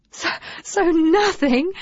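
chuckling. Acted. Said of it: "chuckled Nesta".

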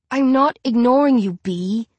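usual speaking voice

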